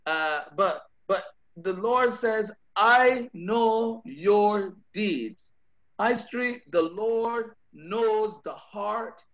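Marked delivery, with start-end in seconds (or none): 2.8-5.5 each word laid down slowly; slow, weighty quote. Acted. Pastor voicing the Lord to the church, slow and weighty. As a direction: let the Lord's words land one by one.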